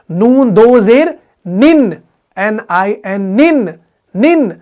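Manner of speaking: निन said slowly, nun with kasra and nasal ending; tanween pronunciation. Shown as a demonstration. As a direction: sound out निन carefully, letter by letter. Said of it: "इसका तलफ़ुज़ अगर आप करोगे".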